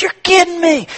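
high, astonished buyer voice; amazed disbelief. Acted. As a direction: gasp it out like you can't believe it. Buyer stunned again.

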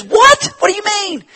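high, loud startled what; a student caught off guard; surprised student. Retold or imitated. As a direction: blurt it out in disbelief. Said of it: "and he goes".

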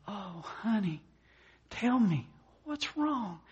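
high, pleading husband comforting his wife; acted coaxing concern. Husband asks his bawling wife what happened, hammed-up tender.

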